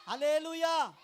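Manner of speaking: high, loud ringing hallelujah; shouted hallelujah. Real, not acted. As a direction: shout it out to the whole church. (గట్టిగా అరిచిన హల్లెలూయా)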